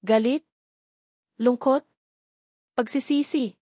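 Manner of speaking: plain reading voice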